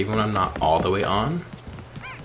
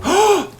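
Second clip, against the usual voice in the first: sharp frightened gasp, high and loud; jolted gasp of alarm; loud startled gasp; 9 dB louder than usual. Real, not acted. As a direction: gasp at the sudden scorpion. Startled by a creature.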